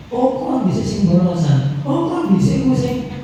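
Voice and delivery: mock a teacher who blames a former pupil, drawn out; blaming teacher. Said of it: "Ada guru yang selalu menyalahkan".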